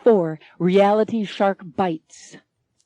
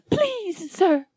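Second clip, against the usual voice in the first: much higher, frightened plea with a catch of tears; trembling plea; 12 semitones higher than usual. Acted. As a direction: beg him, voice near tears. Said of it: "Anne is trembling".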